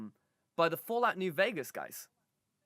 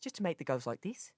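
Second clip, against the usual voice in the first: ordinary delivery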